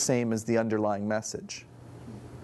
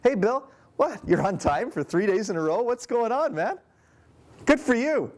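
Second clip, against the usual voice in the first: mock surprise, pitch leaping higher; sarcastic; 10 semitones higher than usual. Shown as a demonstration. A manager mocking Bill for finally being on time, sarcastic. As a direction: praise him but mean the opposite. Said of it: "if I do it this way".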